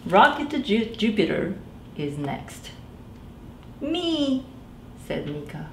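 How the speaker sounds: lively, animated read-aloud voice; reading to children. A narrator's reading. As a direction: tell it like a bedtime picture book. Reads picture book aloud.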